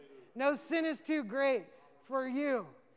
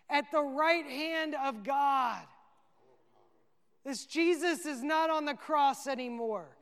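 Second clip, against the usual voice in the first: preaching at full force; 5 dB louder than usual. Real, not acted. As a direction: proclaim the vision to the crowd. Preaching climax about Stephen's vision.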